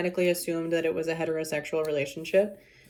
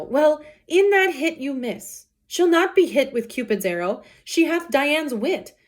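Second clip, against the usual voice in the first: raised, declaiming Shakespeare reading; dramatic Romeo recital; 8 semitones higher, 4 dB louder than usual. Acted. Teacher performing Romeo's lament to class, impassioned. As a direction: deliver the oxymorons like a lovesick hero.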